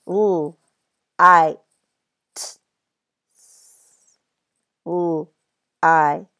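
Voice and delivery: each sound said apart, l-i-t-s; segmented sounds. Shown as a demonstration. Teacher sounds out a word for first graders, sound by sound. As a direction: sound out "Ooh, I, t" slowly, sound by sound. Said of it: "listen as I say the sounds".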